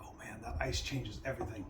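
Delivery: hushed whisper after a sip; awed whisper. Real, not acted. Tasting bourbon with ice, awed whisper. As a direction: breathe it out in awe.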